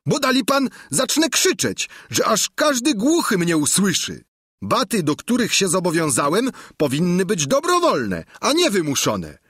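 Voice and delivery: high-pitched squire arguing back; high, insistent character voice refusing his master; Sancho, protesting. A narrator's reading. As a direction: argue your way out in a high voice.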